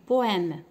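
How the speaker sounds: بويم said loudly with an open è; teacher modelling poème; open è demo. Shown as a demonstration. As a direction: stress بويم with a clear open è. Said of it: "بويم كنمشي مع لاكسون".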